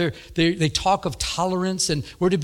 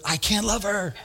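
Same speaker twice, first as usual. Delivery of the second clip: higher-pitched, mock-exasperated outburst; exasperated protest; 5 semitones higher than usual. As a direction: protest to God in comic exasperation. Retold protest to God.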